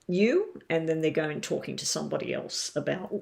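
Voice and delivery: ordinary delivery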